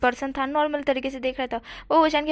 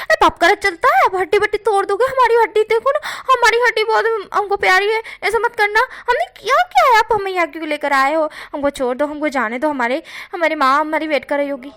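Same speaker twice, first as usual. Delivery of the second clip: much higher, loud yelling plea, easing toward the end; shouting plea; 7 semitones higher, 6 dB louder than usual. Acted. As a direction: yell your protest so they cover their ears. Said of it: "Bhushan चिल्ला चिल्ला के बोल रहा था". (ज़ोर ज़ोर से चिल्लाकर गिड़गिड़ाना)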